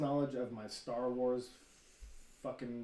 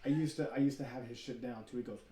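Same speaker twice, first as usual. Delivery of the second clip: ordinary delivery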